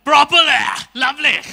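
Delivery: put-on very loud, affected South Bombay accent; hammed-up South Bombay English, almost shouted; proper South Bombay English. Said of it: "if you spoke English properly".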